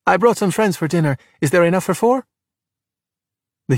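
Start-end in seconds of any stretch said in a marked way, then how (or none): 0.0-2.3 high, casual request; higher, cheerful calling voice. A narrator's reading. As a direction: breezily ask dad across the room. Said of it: "Giulia called".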